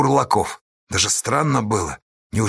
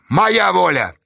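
raised, decisive voice; firm; 7 semitones higher, 4 dB louder, slower than usual. A narrator's reading. Astasha asserting his will, firm. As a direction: assert your authority. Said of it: "твёрдо сказал Асташа".

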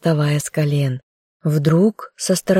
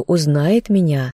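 usual speaking voice

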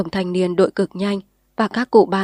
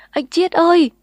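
loud, much higher sobbing wail; girl wailing over him; 10 semitones higher, 6 dB louder than usual. A narrator's reading. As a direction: cry his name in anguish. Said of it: "cô khóc ầm lên".

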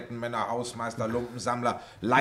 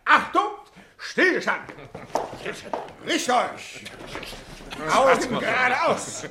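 barked drill commands; 5 semitones higher than usual. Acted. Mock military roll call.

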